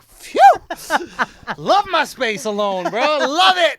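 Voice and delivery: high, loud, slow relief; relieved and gleeful. Retold or imitated. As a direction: gloat with exaggerated relief. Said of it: "I was like".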